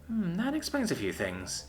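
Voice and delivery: thoughtful drawn-out musing; pondering. A narrator's reading. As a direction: mull it over slowly. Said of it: "she pondered".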